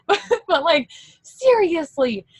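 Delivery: high giggly laughter through words; laugh, then get serious; real giddy laugh. Real, not acted.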